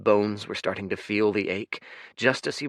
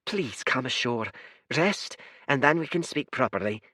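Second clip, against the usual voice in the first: higher but gentle, calm and apologetic; 6 semitones higher than usual. Acted. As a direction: soothe the guest. Blue one invites Fate.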